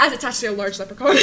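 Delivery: normal talking voice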